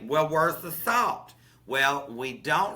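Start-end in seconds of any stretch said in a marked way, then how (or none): 0.0-1.3 mock listener question. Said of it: "y'all say".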